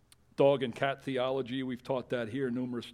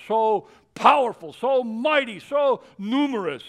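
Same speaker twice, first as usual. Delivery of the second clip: loud, high, soaring scripture reading; preacher at full cry; full-force preaching; 10 semitones higher, 11 dB louder than usual. Acted. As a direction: thunder the prophecy out.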